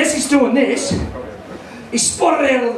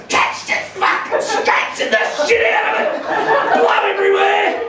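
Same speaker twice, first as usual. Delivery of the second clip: put-on panicked kid; 9 semitones higher than usual. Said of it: "he goes".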